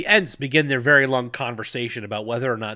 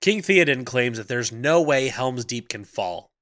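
ordinary talk, nothing marked

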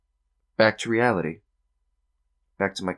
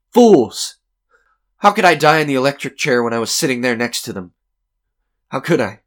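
indignant, raised-voice protest; scornful outburst; 6 semitones higher, 10 dB louder than usual. Acted. Condemned man rejecting the talk of his death, indignant. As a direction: burst out loud and high, then ease slightly.

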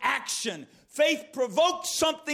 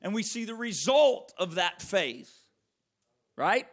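ordinary talk

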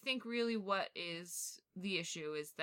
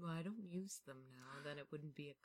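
spooky butler; 3 semitones lower, 9 dB quieter than usual. Acted. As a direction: hush it like a eerie butler showing his house. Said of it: "sounds like a spooky butler".

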